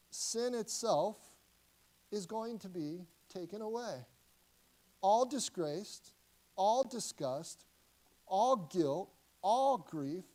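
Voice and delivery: loud, slow, each phrase hammered out; preaching at full force. Acted. Preacher to congregation, declaring sin and guilt gone, loud and slow. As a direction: proclaim it like a verdict.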